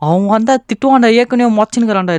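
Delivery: loud, high cry of alarm; high-pitched, exasperated yelling; panicked outburst. Real, not acted. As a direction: shout in dismay as it all goes wrong. Game mishap, panics at the mess.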